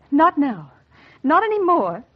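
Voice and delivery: rising outburst. Acted. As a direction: throw off being sensible, pitch climbing.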